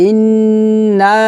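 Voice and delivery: "ইন্না" chanted with a long nasal hum; long ghunnah. Shown as a demonstration. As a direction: hold the n of "ইন্না" very long, then stretch the a. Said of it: "গুণনা করব". ("ইন্না"-তে লম্বা গুন্নাহ)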